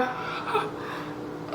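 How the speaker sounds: sharp breathless gasp; shocked gasp. Retold or imitated. Caught snooping on his phone, a shocked gasp. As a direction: gasp like you've just been caught.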